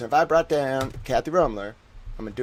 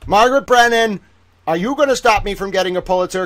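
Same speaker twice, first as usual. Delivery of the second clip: mock accusing shout; 6 semitones higher, 7 dB louder than usual. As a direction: call her out across the room. Calling out accusingly.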